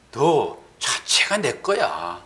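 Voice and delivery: low and slow, each word given room; slow calm God's voice. Retold or imitated. God telling believers, slow and calm, that they belong to Him. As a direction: say it gently and with unhurried certainty. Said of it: "우리가 딱 그렇다고요".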